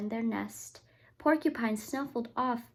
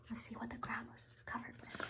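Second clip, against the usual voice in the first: hushed, building suspense; whisper; 16 dB quieter, faster than usual. Real, not acted. Parent teasing the reveal to the child in a whisper. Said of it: "suspense aside".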